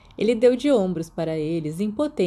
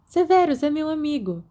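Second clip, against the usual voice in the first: withering; 8 semitones higher than usual. A narrator's reading.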